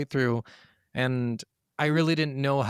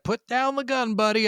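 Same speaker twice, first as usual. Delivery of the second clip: high, coaxing plea to a friend; high soothing plea; mock pleading; 9 semitones higher, 3 dB louder than usual. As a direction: talk him down, pitched up. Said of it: "you're just like".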